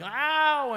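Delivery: high drawn-out mock howl; mock scream. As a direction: fake a long wail for the staged beating. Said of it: "screaming and yelling and crashing and banging".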